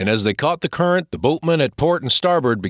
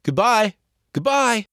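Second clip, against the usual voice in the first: calling out; 9 semitones higher than usual. Acted. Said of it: "waving and calling out".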